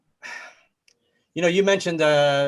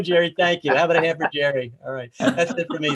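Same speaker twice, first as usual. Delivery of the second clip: ordinary delivery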